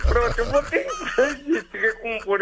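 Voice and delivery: trying not to laugh; giggling while trying to be serious; hysterical laugh. Real, not acted. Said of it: "Eu tô tentando ficar sério, mano, não dá".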